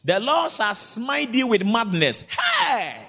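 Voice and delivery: high, slow shouted scripture; preaching at full force. Acted. Preacher booming a curse over the church. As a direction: thunder the curse at the congregation.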